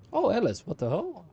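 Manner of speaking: loud, high burst of surprise; real shocked outburst; startled exclamation. Real, not acted. Startled as Alice appears in game.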